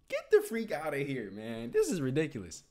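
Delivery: ordinary delivery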